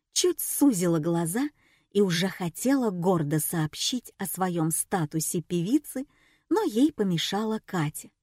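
plain reading voice

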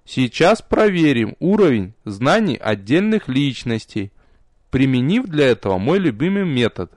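ordinary delivery

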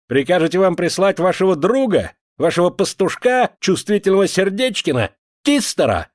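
heated sarcastic cry, losing control; angry taunting shout. A narrator's reading. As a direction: call mockingly after someone walking away. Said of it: "закричал ей вслед Авдей".

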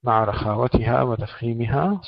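normal talking voice

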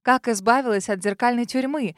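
usual speaking voice

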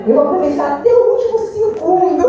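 playfully eager. Real, not acted. Speaker joking with her audience about remaining time, playfully eager. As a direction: gleefully claim the time, laughing at the end.

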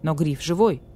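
ordinary delivery, nothing marked

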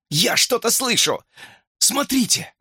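high, alert cry; excited exclamation. A narrator's reading. Hamster rousing his dozing friends, excited exclamation. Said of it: "его возглас нас вмиг разбудил".

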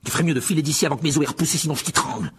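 put-on boy threatening the elf, furious and rushed; furious threat. A narrator's reading. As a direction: snap at the elf in a rising fury. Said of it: "d'un ton féroce".

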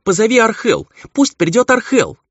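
loud, high-pitched calling out; high, insistent demand; urgent summons. A narrator's reading. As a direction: call urgently for someone to come. Urgent summons acted in the story.

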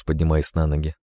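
ordinary delivery

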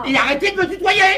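protestation aiguë et exaspérée; emporté. Acted. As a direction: exploser contre le tutoiement, voix qui grimpe. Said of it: "Eh oui, calme-toi". (hors de lui, exaspéré)

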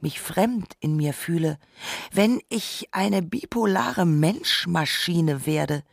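ordinary talk, nothing marked

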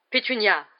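loud exclaimed greeting; booming aunt's hello; crying out. A narrator's reading. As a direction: cry out the name in greeting. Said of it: "s'écria la tante Marge".

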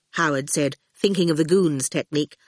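usual speaking voice

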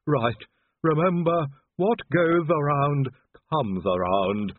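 slow last words of a dying servant; Igor. A narrator's reading.

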